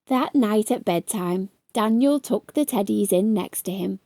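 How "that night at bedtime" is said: ordinary delivery, nothing marked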